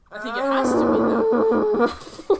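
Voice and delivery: slow, loud, anguished screaming wail; screaming crying fan. Pretend. Confirming young and old Captain America.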